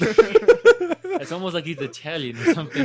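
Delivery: laughing. Real, not acted. Teasing about hairy legs.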